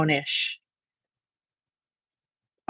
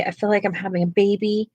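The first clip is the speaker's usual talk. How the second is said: ordinary talk, nothing marked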